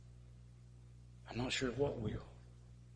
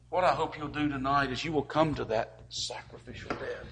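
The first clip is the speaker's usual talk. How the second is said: ordinary talk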